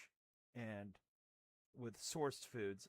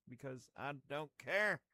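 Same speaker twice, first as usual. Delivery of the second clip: defiant; 2 semitones higher, 9 dB louder than usual. Pretend. Defiant punchline.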